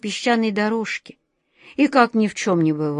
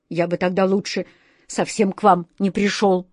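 ordinary delivery, nothing marked

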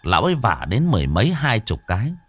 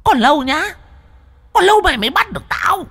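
much higher, loud, fast ghostly jeer; defiant taunt; 3 dB louder than usual. Acted. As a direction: fling a mocking threat from afar. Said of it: "đầy thách thức".

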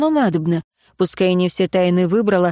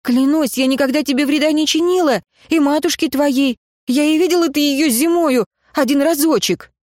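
high, urgent pleading; pleading oath; 7 semitones higher than usual. A narrator's reading. Girl swearing she never harmed his mother, pleading. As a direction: swear your innocence in a high, pressing voice. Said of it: "взмолилась я".

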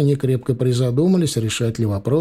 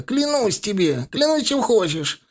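desperate swearing; 9 semitones higher than usual. A narrator's reading. Thug swears under duress.

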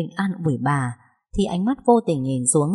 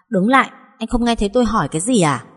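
angry demand; 5 semitones higher, 4 dB louder than usual. A narrator's reading. Grabs his arm, demanding.

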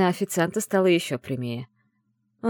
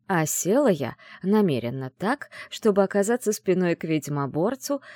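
usual speaking voice

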